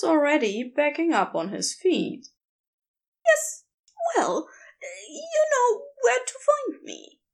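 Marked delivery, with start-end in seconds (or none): 3.2-7.2 much higher, squeaking nervously; squeaky reply. Acted. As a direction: squeak it out while backing away. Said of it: "Aziraphale squeaked".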